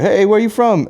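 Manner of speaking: bright put-on greeting, mocking the vendor; acted greeting. Vendor greeting the huffing customer, mock high call.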